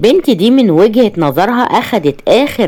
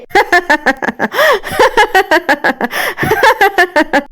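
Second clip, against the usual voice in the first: high, unhinged cackling laughter; hysterical laugh; 12 semitones higher than usual. Acted. Ruined wife breaks into mad hysterical laughter. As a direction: laugh like she's lost her mind. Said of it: "وقفت تضحك بهستيريا".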